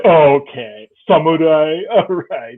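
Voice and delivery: mocking dismissal. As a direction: scoff at a show-off in mock disbelief. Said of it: "they look at me like I'm trying to be".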